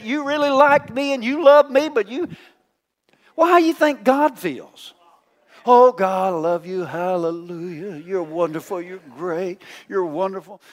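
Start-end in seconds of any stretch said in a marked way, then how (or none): 5.7-10.6 softer and softer, syrupy mock praise to God; butter God up in a hushed, sugary voice; mock sugary prayer. Preacher mimics a churchgoer flattering God while scorning the spouse, syrupy and soft. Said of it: "Why are you talking like that?".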